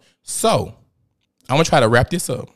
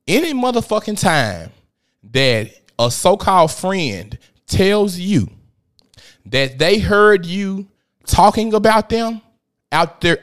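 fired-up sermon; 5 semitones higher, 4 dB louder than usual. Real, not acted. Preaching lesson to audience.

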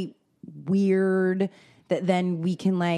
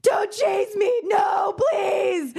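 put-on high, slow panicked child's shout; terrified child screaming; 13 semitones higher, much slower than usual. Mother voicing her son's night-terror screams.

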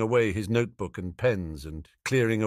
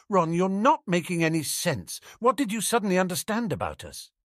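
put-on slightly higher, faster girl's voice; a hint of Ginny, puzzled; 8 semitones higher than usual. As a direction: press him for an answer. Ginny confused, pressing Ron.

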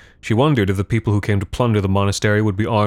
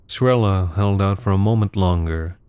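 ordinary delivery

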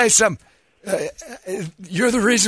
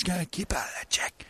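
whisper; 5 semitones lower, 16 dB quieter, faster than usual. Acted. Aside hushed to Jack.

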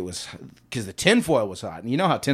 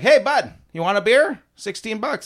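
high, loud buddy hail; a hint of a pushy beer seller; chummy bartender; 9 semitones higher, 5 dB louder than usual. Offering the guy on the floor a pricey beer, chummy. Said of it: "And then I'm like".

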